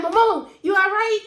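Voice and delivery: high, loud call to mom; calling out to check she's okay; anxious call. Retold or imitated. Calling to mom after storm.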